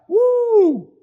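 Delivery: high, loud woo of excitement; excited whoop. Real, not acted. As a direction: let out a loud happy whoop. Preacher's cheer after verse.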